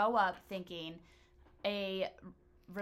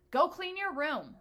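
higher, louder parent's command; sharp, raised-voice order to the kids; snapped order; 9 semitones higher, 6 dB louder than usual. Acted. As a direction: snap it like an irritated mom. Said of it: "totally snapped at me".

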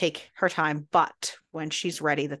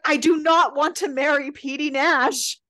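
higher, louder flat refusal; pitched-up, loud mock declaration; emphatic refusal; 8 semitones higher, 5 dB louder than usual. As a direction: declare it so nobody can argue. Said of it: "He's like".

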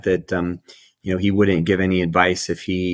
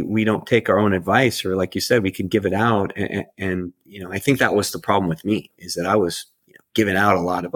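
ordinary delivery

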